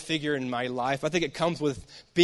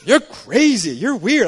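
brother scoffing; 5 semitones higher, 9 dB louder, slower than usual. Retold or imitated. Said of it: "He goes".

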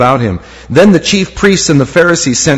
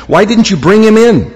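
higher-pitched, demanding challenge; demanding question; 6 semitones higher, 3 dB louder than usual. A narrator's reading. Pharisees question the returning guards, demanding. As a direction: question them as if they failed.

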